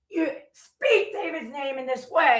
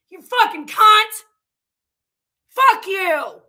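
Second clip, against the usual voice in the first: loud, higher, spat out; furious shout; 4 semitones higher, 7 dB louder, slower than usual. Real, not acted. Lashing out at the commenter who accused her, shouting furiously. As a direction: curse them out at full volume.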